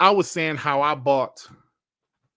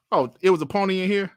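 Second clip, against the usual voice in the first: ordinary talk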